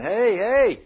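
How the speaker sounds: loud, high attention-getter; acted warning call. Holy Spirit interrupts the grumbling driver, acted warning call. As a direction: call out to stop someone short.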